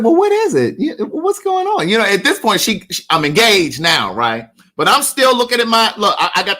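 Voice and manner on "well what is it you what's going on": puzzled. Retold or imitated. Pressing the teary fan to say why, puzzled. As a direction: playfully urge the crying stranger to get to it. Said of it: "And I'm just like".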